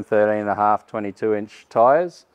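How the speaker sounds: ordinary talk, nothing marked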